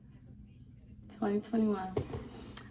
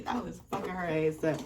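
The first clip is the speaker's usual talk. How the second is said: ordinary talk, nothing marked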